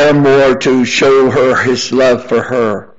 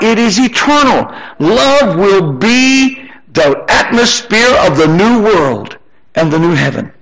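rising preaching; 5 semitones higher than usual. Real, not acted. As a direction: build the sermon upward, stretching the big words. Preaching rises to full force.

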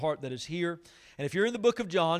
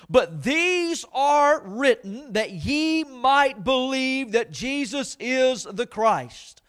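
slow, weighty King James reading; solemn scripture reading; 5 semitones higher, 5 dB louder, slower than usual. Acted. Pastor reading Lazarus passage to the church, slow and solemn. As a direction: let each phrase land slowly. Reads John 20 slowly.

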